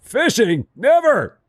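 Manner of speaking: high, loud imitation of outrage; mock outraged refusal. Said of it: "It's like".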